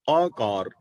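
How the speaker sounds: ordinary talk, nothing marked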